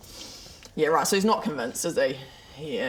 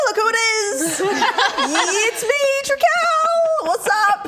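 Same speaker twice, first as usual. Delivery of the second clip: much higher, louder, showy vampire voice; flamboyant Trikel; 6 dB louder, much slower than usual. Acted. Player disguised as Trikel greeting the guard. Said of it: "Does he talk like that?".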